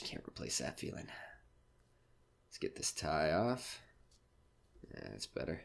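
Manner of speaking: soft, tired muttering alone; winding down under his breath; quiet to himself. Acted. Comic alone after the show, tired and quiet.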